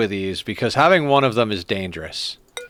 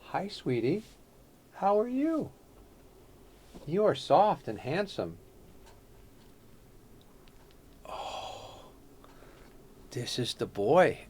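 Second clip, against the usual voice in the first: cooing to cat; 13 dB quieter, slower than usual. Real, not acted. Streamer coos to the cat on his shoulder, soft and tender. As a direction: murmur tenderly to the cat right by your ear.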